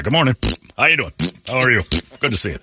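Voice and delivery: bright, eager hello to everyone; friendly Rock Hudson greeting. Retold or imitated. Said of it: "And Rock would just go by".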